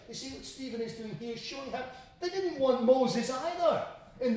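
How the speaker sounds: high-pitched, insistent pulpit voice; animated, emphatic preaching; forceful preaching. Acted. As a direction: drive the point home to the congregation.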